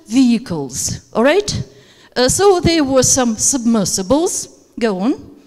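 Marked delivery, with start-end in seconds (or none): 2.6-4.7 "submersibles" climbs at the end; rising list tone. Shown as a demonstration. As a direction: lift the end of "submersibles", more items to come. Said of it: "rising tone and falling tone at the end".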